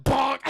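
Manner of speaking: loud, high imitated impact; sudden mock thwack; shouted bonk. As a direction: punch it out like a cartoon blow. Imitates a blow to the head.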